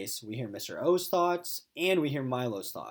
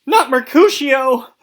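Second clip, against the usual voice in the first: frantic high shriek at the dog; scream; 12 semitones higher, 19 dB louder, slower than usual. Acted. Owner screaming at her chewing dog, loud high yell. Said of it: "I screamed".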